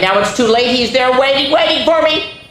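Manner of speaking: put-on a child spooking himself in bed; scared boy. Frightened boy in story.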